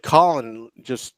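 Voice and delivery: usual speaking voice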